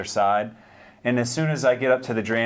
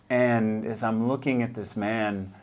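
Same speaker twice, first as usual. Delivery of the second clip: usual speaking voice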